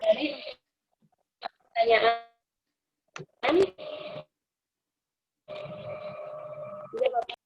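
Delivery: choppy, dropping-out online call; breaking up audio. Real, not acted. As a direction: voice cutting in and out. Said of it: "suaranya putus-putus".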